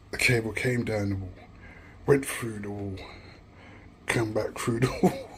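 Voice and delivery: ordinary talk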